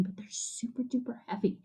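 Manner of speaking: fast, breathy stage whisper. Acted. Teacher telling kids koalas are heavy, whispered. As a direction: let them in on a secret.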